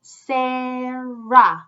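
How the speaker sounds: Sarah split into two long, drawn-out syllables. Shown as a demonstration. Teacher showing how often the mouth opens, drawn out. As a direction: stretch each syllable of Sarah. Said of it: "when I say".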